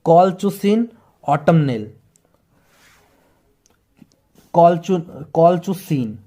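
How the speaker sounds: कॉलचुसिन slow and careful, kol-chu-seen; slow, deliberate teaching of कॉलचुसिन; pronunciation demo. Shown as a demonstration. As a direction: model कॉलचुसिन slowly for learners. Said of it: "इसका ऐसे pronunciation होता है".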